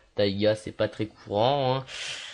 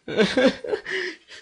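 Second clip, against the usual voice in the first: high-pitched laugh; 3 dB louder than usual. Real, not acted. Laughs at being caught.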